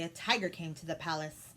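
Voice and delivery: plain reading voice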